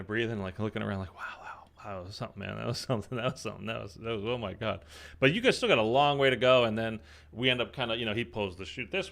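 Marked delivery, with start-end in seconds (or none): 1.1-4.8 breathless, hushed awe; soft stunned whisper; awed whisper. Retold or imitated. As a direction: murmur it like you've just landed in wonder. Awed after chute opens, retold.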